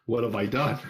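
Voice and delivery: mock-horrified self-reproach; horrified realization. Teller sees the mess behind him, horrified.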